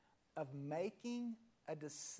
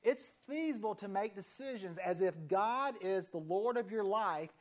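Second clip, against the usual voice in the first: normal talking voice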